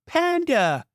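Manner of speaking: high sharp cry of a name; cried out. A narrator's reading. Sister crying out for her lost toy, high. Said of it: "his sister cried".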